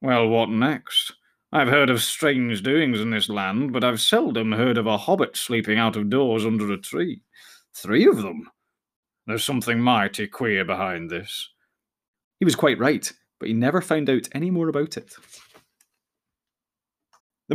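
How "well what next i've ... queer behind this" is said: a sly fox puzzling to himself, slowly; musing fox. A narrator's reading. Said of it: "he thought".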